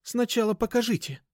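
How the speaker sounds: put-on high, insistent girl's voice; demanding. A narrator's reading. Girl wants goods shown first, high demanding tone. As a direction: insist firmly, pitched high. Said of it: "требовательно проговорила Даша".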